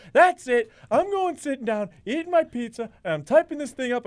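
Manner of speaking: high-pitched, fed-up announcement; re-enacted agitated outburst; retold worked-up declaration. Re-enacts himself working in the newsroom.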